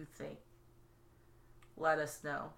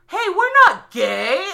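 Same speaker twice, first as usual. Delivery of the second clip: high, loud and slow mock protest; shocked wrestlers; 10 semitones higher, 13 dB louder than usual. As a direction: shout it up high and slowly, hammed up. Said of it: "they realize, like".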